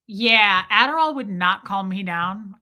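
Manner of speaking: normal talking voice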